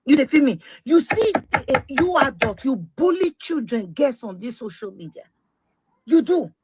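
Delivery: ordinary delivery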